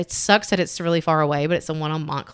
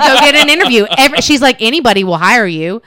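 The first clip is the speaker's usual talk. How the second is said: mom lecturing; 6 semitones higher, 9 dB louder than usual. Retold or imitated. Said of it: "started lecturing him like she does".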